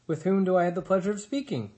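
bright, high, quick and polite; friendliest voice. A narrator's reading. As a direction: sweeten it to calm the bears. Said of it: "the robot's friendliest voice".

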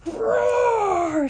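very high, loud roaring cry; bear roar. A narrator's reading. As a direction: roar in a frenzy. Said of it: "said the sister bear".

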